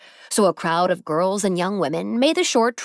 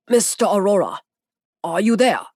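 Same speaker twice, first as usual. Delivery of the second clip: put-on Masaru in a heavy foreign accent, louder; 4 dB louder than usual. A narrator's reading. Masaru answers Zeke's call, in a heavy foreign accent. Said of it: "Masaru said in his heavy accent".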